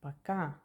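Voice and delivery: "пока" with the last syllable stressed. Shown as a demonstration. Teacher pointing out the stress in пока to learners.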